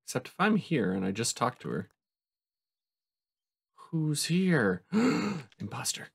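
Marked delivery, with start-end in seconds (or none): 4.9-5.5 sharp gasp of surprise; surprised gasp. Real, not acted. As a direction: gasp as if caught off guard. Surprise at the imposter.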